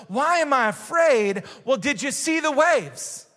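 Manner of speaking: high-pitched, indignant questioning; incredulous protest. Acted. Disciple in the boat answers Jesus, incredulous protest.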